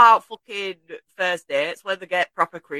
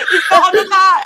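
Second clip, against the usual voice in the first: laughing; 8 semitones higher, 9 dB louder than usual. Real, not acted. Laughing reply to co-host.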